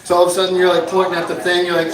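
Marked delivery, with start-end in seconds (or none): none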